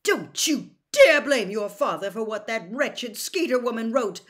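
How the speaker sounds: a mother rounding on her son; flaring up. A narrator's reading. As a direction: snap at him in defence of her husband. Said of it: "flaring up at once".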